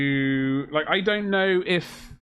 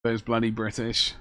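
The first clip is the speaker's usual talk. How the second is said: ordinary talk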